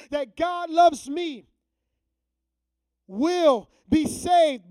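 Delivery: building sermon climax; preaching at full force. Acted. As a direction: drive the promise home to the congregation.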